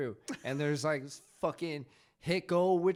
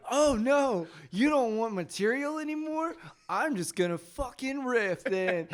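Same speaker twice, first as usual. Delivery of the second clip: higher, louder sarcastic jab at the crowd; mock defiant retort; 7 semitones higher, 4 dB louder than usual. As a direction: play it cocky and dismissive. Mocking friend's defiant reply to hecklers.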